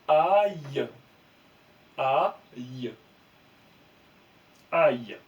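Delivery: aïe dit fort et net, comme un son modèle; détacher aïe clairement; lire aïe. Shown as a demonstration. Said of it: "Donc ça va faire". (aïe prononcé avec insistance)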